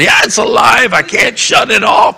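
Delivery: put-on high, loud cry of the boy; high-pitched anguished yell; desperate boy shouting. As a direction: shout it out like a kid who can't make it stop. Boy crying out in distress.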